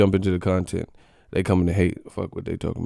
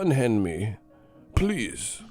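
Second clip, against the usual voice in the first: mock British gentleman protesting; polite British accent; 3 semitones higher than usual. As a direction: plead politely as a put-on Brit. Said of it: "like, a British dude".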